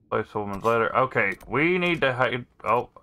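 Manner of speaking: usual speaking voice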